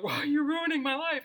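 high, exasperated accusing cry; mock outrage. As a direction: throw the accusation at her, hammed up. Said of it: "that opportunity to be like".

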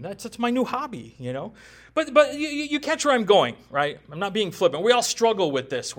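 ordinary talk

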